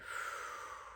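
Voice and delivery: soft unvoiced exhale; breathed-out sigh. Shown as a demonstration. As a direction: blow the breath gently out. Breathing out during guided healing.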